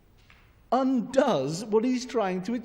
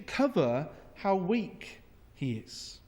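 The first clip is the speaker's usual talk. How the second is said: usual speaking voice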